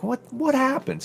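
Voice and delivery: high, puzzled what happened; retelling a baffled moment, pitch jumps up. Retold or imitated. Remembering the dead battery, asking in high surprise.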